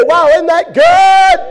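high, loud, slow wow; nudging the sleeper with loud delight; excited whisper-nudge. Pretend. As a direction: gush to your pew neighbor. Nudging neighbor in church.

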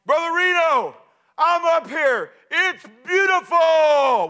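loud, high excited call; summit shout. Retold or imitated. Stephen calling down to Reno from the summit, shouting. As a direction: yell down from the peak in triumph.

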